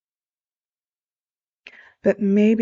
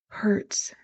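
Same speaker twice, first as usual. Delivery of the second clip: whispered for emphasis; 6 dB quieter than usual. Real, not acted. Speaker to audience, truth hurts, whispered. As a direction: drop to a breathy whisper on the last word. Dramatic point about painful truth.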